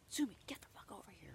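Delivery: harsh whispered scolding, fast; mock angry whisper. Owner demonstrating how not to scold her dog, whispered and fast. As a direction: hiss the order at the dog under your breath. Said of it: "If I yelled at her".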